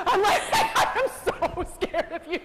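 high, loud, slowed words through laughter; can't stop laughing as you say it; laughing hard. Real, not acted. Telling the audience how scared she was, laughing loudly. Laughing retelling her admission.

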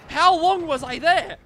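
high, slow, incredulous outcry; exasperated disbelief. Real, not acted. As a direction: demand it in drawn-out disbelief. Realises wasted time in the vent.

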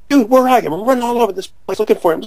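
high, exasperated phone rant; retold frantic complaint. Calling Jimmy on his cell, high frantic complaint. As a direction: start high and rush the second sentence.

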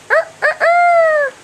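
high rooster crow. Pretend.

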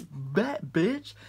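ordinary talk

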